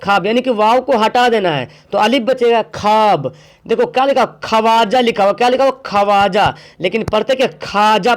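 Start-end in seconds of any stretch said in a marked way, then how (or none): none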